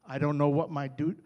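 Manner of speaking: ordinary delivery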